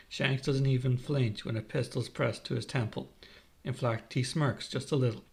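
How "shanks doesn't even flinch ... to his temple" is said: ordinary delivery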